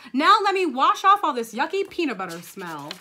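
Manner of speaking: put-on big, lively child voice; grossed-out kid. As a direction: announce it with exaggerated yuck. Gabby eager to be rid of it.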